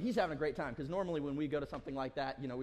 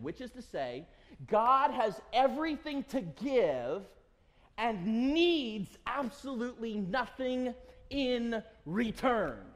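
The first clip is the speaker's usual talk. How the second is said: slow, loud, swelling sermon delivery; preached reading; 7 semitones higher, 6 dB louder, much slower than usual. Acted. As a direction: read it as from the pulpit, with weight. Reads a quoted devotional passage.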